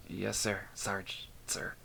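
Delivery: a quiet, cowed yes sir; mumbled. Acted. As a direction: mumble it with your head down. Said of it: "Mace mumbled as he lowered his head".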